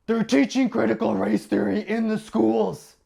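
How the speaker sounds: high, loud panicked parent voice; alarmed parent. Acted. Worried parent warning the neighbours, high and loud. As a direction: raise the alarm like a frantic parent.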